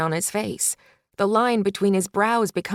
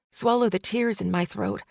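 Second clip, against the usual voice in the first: usual speaking voice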